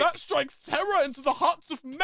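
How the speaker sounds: high, loud, laughing protest; mock indignant proclamation. Fan defending a scary wrestler, hammed-up high outrage. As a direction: proclaim it loudly, cracking up.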